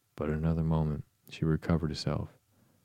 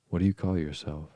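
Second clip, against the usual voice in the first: usual speaking voice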